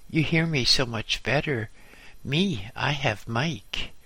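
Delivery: ordinary delivery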